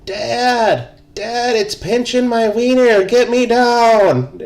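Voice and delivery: very high, loud mimicked child's squeal; squealing kid. Imitating a kid in a harness begging Dad to get him down, squealing. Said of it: "all we hear squealing from the top".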